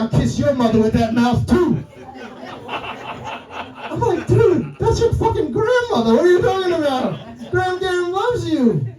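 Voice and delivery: usual speaking voice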